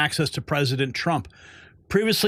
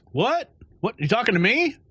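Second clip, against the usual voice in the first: mock indignant reporter; 6 semitones higher than usual. Acted clash in the press room.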